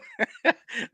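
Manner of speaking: short high giggle. Real, not acted. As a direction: giggle through the memory. Laughing mid story.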